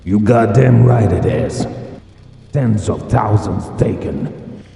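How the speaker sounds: loud, high, fierce reply; furious retort. Acted. Major fires back at the commander, furious. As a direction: roar back at her.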